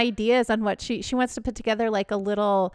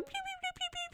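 chick peeps; 19 dB quieter, much faster than usual. Retold or imitated. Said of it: "you can hear them going".

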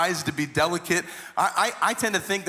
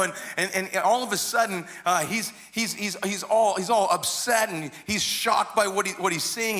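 ordinary delivery

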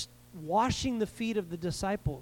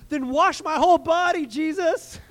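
much higher and louder, gushing overboard; eager impulsive Peter; 8 semitones higher, 7 dB louder than usual. Retold or imitated. Peter overcorrecting to Jesus, a high loud eager outburst. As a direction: blurt it out, all in, over the top. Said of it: "in classic Peter style".

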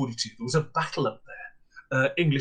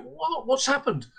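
much higher, disbelieving repeat; incredulous echo; 11 semitones higher than usual. Retold or imitated. Landlady repeats the guest's words back, high and incredulous.

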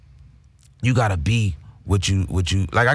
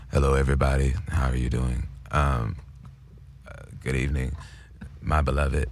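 low, soft, breathy-seductive [name] greeting; [name] impression; 10 semitones lower, 6 dB quieter than usual. A podcast host doing [name] greeting the crowd, low and soft. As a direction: purr it out like [name] greeting fans. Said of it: "[name] was a little dude".

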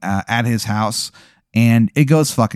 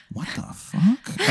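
slow, hushed disbelief; baffled mutter; 9 semitones higher, 8 dB quieter, much slower than usual. Retold or imitated. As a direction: mouth it quietly, stunned. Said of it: "you can see him kinda going".

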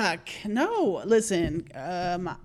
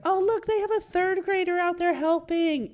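other parent; 8 semitones higher than usual. Retold or imitated. Parents' neighbours at school show.

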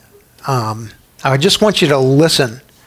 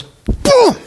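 a sudden hammed-up boom; cartoon punch sound effect. Cowboy lands one punch.

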